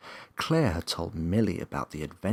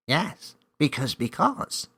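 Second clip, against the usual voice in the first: high, louder cheeky elf; cheeky reply; 8 semitones higher, 6 dB louder than usual. A narrator's reading. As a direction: toy with her, playfully. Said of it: "he cheekily replied".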